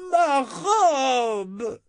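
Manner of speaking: high shrill squeal. Acted. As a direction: squeal like a spoiled noblewoman. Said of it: "couina dame Ordite".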